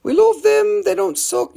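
high excited imitation; mock eager buyer. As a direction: squeal it like a greedy buyer. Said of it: "And be like".

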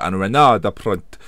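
put-on player's name with a playful French accent; lightly put-on French pronunciation. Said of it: "I said it in that French accent".

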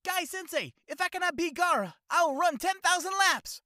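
very high, loud fired-up shout; eager anime student. Retold or imitated. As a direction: scream it with youthful fire. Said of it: "anime impression video".

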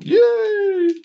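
very high, loud squealed cheer; mock cheer. Celebrating that everybody's in the wrong, high mock cheer. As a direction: fake delight, squeal it out.

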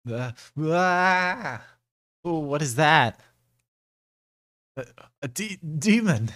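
high, loud panicked shriek; terrified scream. Acted. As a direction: scream in terror at the demon. Character spots a demon, screams.

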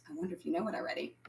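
quick quiet aside. Real, not acted. Teasing aside to children.

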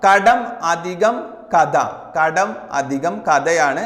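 slow dictation. Shown as a demonstration. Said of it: "കടങ്കഥ".

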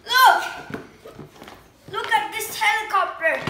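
high, loud bursts of real delight; amazed excitement. Real, not acted. Vlogger unveils the helicopter to viewers, amazed and excited. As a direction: exclaim in open amazement, pitch leaping up. Unboxing reveal, amazed by the toy.

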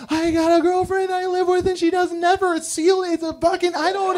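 high, frantic pleading voice; pleading with cop. Retold or imitated. Telling a cop why he's speeding, high frantic plea. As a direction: beg the cop in a high rush. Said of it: "I would have been like".

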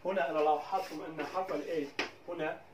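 normal talking voice

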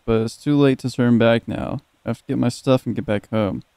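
ordinary talk, nothing marked

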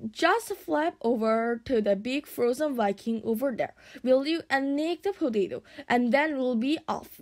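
usual speaking voice

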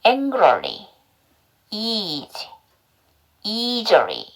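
"angrily", "easy", "easily" one at a time, carefully separated; repeat-after-me model. Shown as a demonstration. Teacher leading learners in practice, repeat-after-me "angrily, easy, easily". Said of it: "저랑 같이 한번 연습해봐요".

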